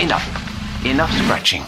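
urgent order to stop; sharp command. Acted. Cuts off the frantic scratching.

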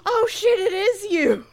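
retold shocked relief at finding the real Doctor; surprised recognition. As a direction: gasp it out as it dawns on you.

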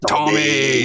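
lightly put-on Peaky Blinders British accent; mock British accent. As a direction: imitate a British gangster calling out. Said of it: "I thought of you two right away".

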